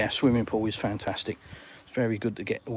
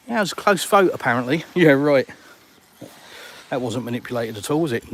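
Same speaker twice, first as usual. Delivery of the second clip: sarcastic; 6 dB louder than usual. Real, not acted. Mocking the second referendum result.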